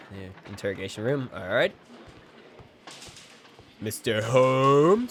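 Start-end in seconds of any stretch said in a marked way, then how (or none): 3.8-5.1 drawn-out mock greeting; put-on game character voice, slow and loud; mimicking game character. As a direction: stretch the name out slowly, louder.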